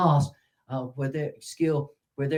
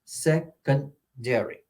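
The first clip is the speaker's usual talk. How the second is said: slow "secondary" broken into "sec", "on", "dary"; stress on se and da; much slower than usual. Shown as a demonstration. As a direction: separate the syllables of "secondary", weighting "sec" and "da". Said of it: "on the se and the da".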